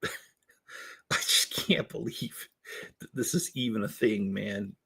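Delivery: breathless laughing disbelief; quiet helpless laughter. Real, not acted. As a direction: wheeze it out, too amused to speak. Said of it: "I can't get through the rest of this".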